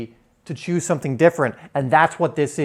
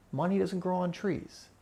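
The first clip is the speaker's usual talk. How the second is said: Money much softer, said calmly from a distance; detached observing; 10 dB quieter than usual. Shown as a demonstration. Teacher shows students observing a money worry, softly detached. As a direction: let the thought pass quietly, without believing it. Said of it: "You're just going like".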